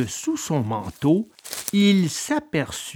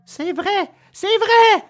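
high-pitched wailing admission; acted weepy little boy; tearful puppet cry; 14 semitones higher, slower than usual. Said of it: "s'écria Pinocchio en pleurant".

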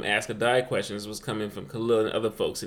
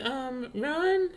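put-on little boy's answer; 12 semitones higher, 3 dB quieter than usual. Son answers his dad.

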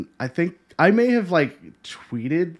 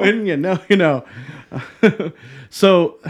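laughing; 3 semitones higher, 4 dB louder than usual. Real, not acted. Laughing at the in-joke.

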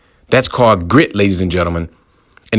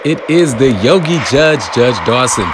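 town crier; 5 semitones higher than usual. Acted. Podcast opening proclamation.

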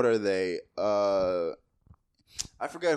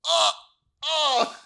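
disgusted gasp; 8 dB louder than usual. Retold or imitated. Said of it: "And I was like".

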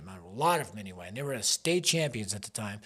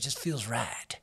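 put-on playful southern accent, lower on the words; 3 semitones lower, 4 dB quieter than usual. Preacher joking about his soul winning days, southern accent. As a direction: say it with a southern twang. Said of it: "I say it with a southern accent".

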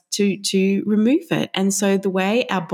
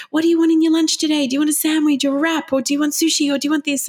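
rapid-fire questions; 8 semitones higher, faster than usual. Retold or imitated. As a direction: fire questions at a four-year-old. Mum firing lunch questions.

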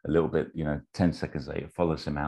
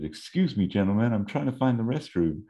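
higher, innocent put-on voice; acted simple-hearted polite interruption; naive interrupter; 7 semitones higher than usual. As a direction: politely butt in, pitched up. Acting Forrest interrupting the leaders.

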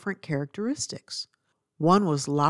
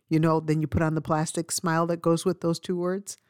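ordinary talk, nothing marked

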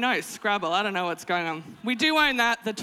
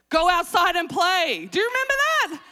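exasperated parent; 7 semitones higher, 6 dB louder than usual. Acted. Mother sending kids outside, loud and exasperated. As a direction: call it out, climbing higher at the end. Acts out parent selling outdoor play.

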